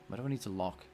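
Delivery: normal talking voice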